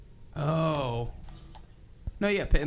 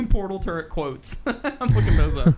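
laughing; 5 semitones higher, 6 dB louder, slower than usual. Real, not acted. Laughing while searching quotes.

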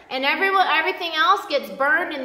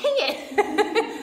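higher, laughing mock grumble; laughing mock frustration; 6 semitones higher than usual. Preacher joking that nobody got baptized, laughing frustration. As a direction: playfully curse your luck through a laugh.

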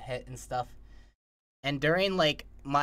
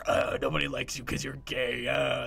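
low, slightly slowed put-on taunting voice; mock bully, pitched down a touch; nasty inner voice; 4 semitones lower, 3 dB quieter than usual. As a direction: play the belittling voice in your head. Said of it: "the other voice is like".

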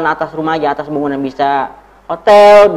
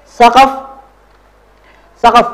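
high, loud model word, evenly repeated; drill call-out; 6 semitones higher, 4 dB louder than usual. Shown as a demonstration. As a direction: call it out and leave room to repeat.

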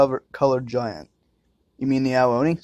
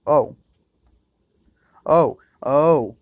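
loud surprised oh; 2 semitones higher, 7 dB louder, much slower than usual. Real, not acted. Sudden realisation during puzzle.

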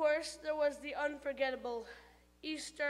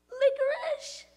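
much higher, louder squeaky tooth voice; tooth calling out; 13 semitones higher, 9 dB louder than usual. Acted. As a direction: blurt it from inside the mouth.

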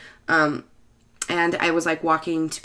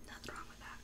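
sarcastic whisper; 31 dB quieter, faster than usual. Real, not acted.

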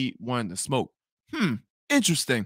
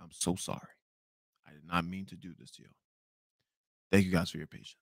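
much softer, lower, embarrassed apology; sheepish apology; 8 semitones lower, 11 dB quieter, faster than usual. Retold or imitated. Apologizing to the guests in the lobby, softly sheepish. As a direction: mumble sorry to the crowd, ashamed.

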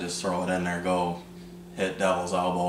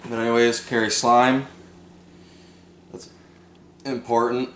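talking through the hiccups; words broken by hiccups; 4 semitones higher, 3 dB louder than usual. Real, not acted. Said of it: "Sorry, I have the hiccups".

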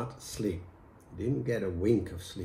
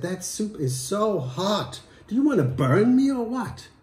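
angry husband; 10 semitones higher, 7 dB louder than usual. Acted. Husband yelling at wife over soup, angry. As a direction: snap at her over the hot soup.

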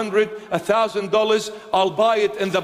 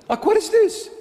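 incredulous; 11 semitones higher, faster than usual. Real, not acted. Incredulous mockery of the fans.

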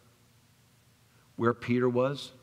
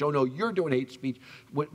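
normal talking voice

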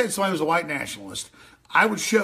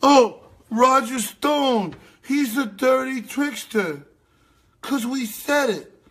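slow, high, loud mock repeat; sarcastic echo; 8 semitones higher, 5 dB louder, slower than usual. Host sneering at the dirty trickster label, slow and high. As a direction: stretch it out with contempt. Mocking the other host's line.

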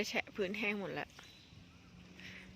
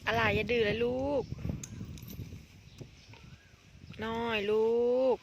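teasing scold; 5 dB louder than usual. Real, not acted. Scolding the young animal.

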